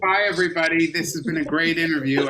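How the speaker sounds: loud, high playful farewell; mock sign-off. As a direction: wrap up the show early as a joke. Joking sign-off after praise.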